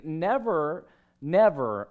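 a firm, emphatic double NEVER; emphatic refusal. Retold or imitated. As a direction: punch each NEVER with force. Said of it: "It's very emphatic".

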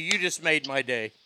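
usual speaking voice

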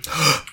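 sharp audible gasp. Pretend. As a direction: gasp at a scandalous revelation.

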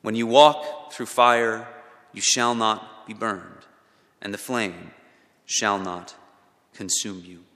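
scripture reading. Acted. Reads Isaiah's promise aloud.

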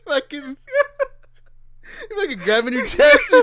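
high, loud words cracking up; crying with laughter; laughing hard. Real, not acted. Laughing at friend.

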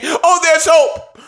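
high, mocking sing-song fan voice; hopeful fan, hammed up high. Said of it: "they come in year after year saying".

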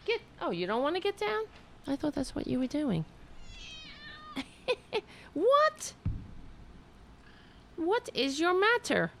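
high, fast cooing at the cat. Real, not acted. Talking to cat on lap.

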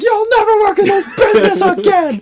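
high, loud, outraged threat; angry manager. Retold or imitated. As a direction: blast it like a furious boss firing someone. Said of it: "he's like".

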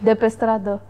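ordinary talk, nothing marked

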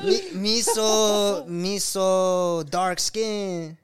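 very slow, drawn-out fake North African accent; hammed-up, muddled Northern African accent; badly faked Northern African accent. Shown as a demonstration. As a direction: stretch every word in a made-up African accent. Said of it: "it's not even a real accent".

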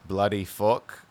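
ordinary delivery

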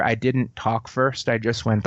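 ordinary talk

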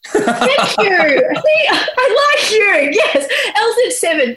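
high-pitched, laughing approval; delighted laughing. Real, not acted. Interviewer thanking singer for saying zed, delighted laughing. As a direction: exclaim it high through laughter.

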